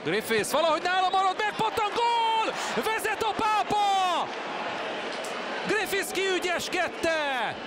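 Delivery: screaming goal call, very high; excited Hungarian sports commentary. Real, not acted. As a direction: erupt as the ball goes in. Goal scored, Pápa leads.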